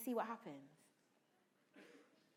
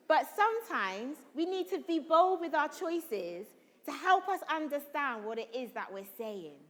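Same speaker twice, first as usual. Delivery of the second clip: ordinary talk, nothing marked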